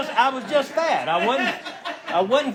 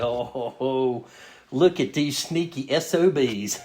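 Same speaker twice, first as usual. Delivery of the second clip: sly suspicion; 6 semitones lower, slower than usual. Retold or imitated. Spotting the church van, suspicious.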